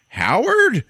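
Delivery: high, loud questioning shout; mock astonished recognition; surprised call. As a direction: call a name across the room in disbelief. Calls out, recognizing a voice.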